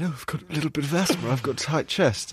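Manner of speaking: quick, slightly put-on voice; imitating himself. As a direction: play the feeble patient. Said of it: "And I went".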